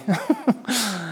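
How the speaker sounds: a brief real laugh; short laugh. Real, not acted. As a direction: let a quick laugh slip out. Laughs after mock quote.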